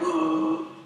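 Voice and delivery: imitated crowd gasp; shocked onlooker gasp. Train riders shocked by her outburst, mock gasp. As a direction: gasp like startled bystanders. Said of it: "people are like".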